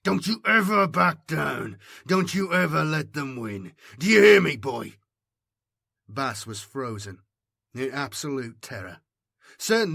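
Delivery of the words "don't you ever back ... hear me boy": put-on loud, higher furious old soldier; loud raging command; fierce shout. A narrator's reading. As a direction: roar it right in his face. Sarge furious in his face.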